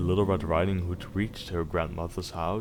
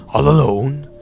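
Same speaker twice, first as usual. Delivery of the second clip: louder, higher woodman calling out; 6 semitones higher, 11 dB louder than usual. Acted. Woodman checks on the girl alone, loud call. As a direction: call out with concern. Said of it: "said he".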